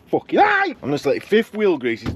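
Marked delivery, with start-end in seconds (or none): 0.1-0.7 much higher yelp of annoyance; real exasperated cry; pained outburst. Real, not acted. Grease in his eye.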